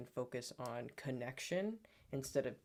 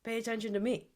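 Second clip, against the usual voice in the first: high, put-on childish plea for attention; needy plea; 9 semitones higher, 4 dB louder than usual. To his wife, wanting to be seen, high childish plea. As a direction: whine it like a child wanting to be noticed. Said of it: "I was like".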